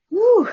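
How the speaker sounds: loud, high "woo" of release; sudden high, loud woo. Real, not acted. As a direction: shake off the emotion with a short whoop. Shaking off an emotional message.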